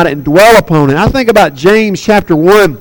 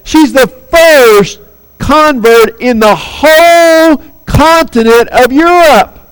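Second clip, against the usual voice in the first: usual speaking voice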